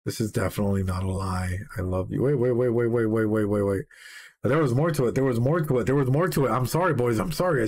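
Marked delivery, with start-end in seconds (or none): 0.0-2.2 lower, softer, tender delivery; hushed loving words; tender confession. Acted. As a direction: murmur it like a secret. Reading tender lyric aloud.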